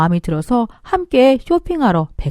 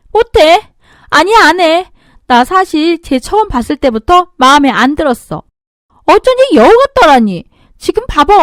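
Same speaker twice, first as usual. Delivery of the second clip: high, loud bratty yelling; defiant shouting; 4 semitones higher, 6 dB louder than usual. Acted. Sister-in-law refusing to apologize, defiant shouting. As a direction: scream your refusal.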